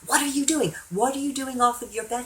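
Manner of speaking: high, loud scolding; yelling nurse. Retold or imitated. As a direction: scold a patient caught out of bed. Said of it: "a nurse turns around and starts yelling at me".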